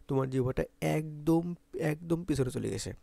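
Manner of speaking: normal talking voice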